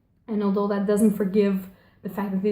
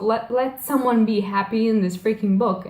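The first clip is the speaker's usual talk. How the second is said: retold outburst at a cruel book; exasperated plea. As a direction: plead with the author, half in earnest. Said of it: "breathe, and just say".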